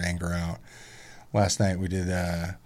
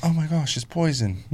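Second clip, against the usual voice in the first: dramatic daughter; 8 semitones higher than usual. Retold or imitated. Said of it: "gets bit by a spider".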